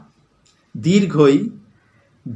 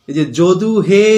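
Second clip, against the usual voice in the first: calling out to someone; 2 semitones higher, 4 dB louder than usual. Shown as a demonstration. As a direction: call out "যদু হে" with the "হে" drawn long. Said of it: "আমরা যদি কাউকে ডাকি".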